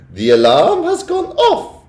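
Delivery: high, loud, slow cackling shriek; gleeful shriek. A narrator's reading. Witch celebrating as the spell works, shrieking and laughing. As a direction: shriek it with delight. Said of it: "shrieked the Grand High Witch".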